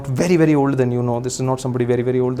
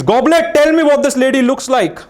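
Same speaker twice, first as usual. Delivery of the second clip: hammed-up call to the magic goblet, higher and louder; mock summoning; 8 semitones higher, 5 dB louder than usual. Mentalist on stage commanding the goblet. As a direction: boom out an order to an imaginary oracle.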